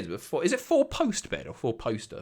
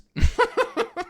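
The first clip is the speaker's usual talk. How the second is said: high burst of laughter. Real, not acted. Laughing at a cut-off chat message.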